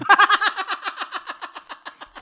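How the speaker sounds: sudden high loud burst of laughter; big laugh. Real, not acted.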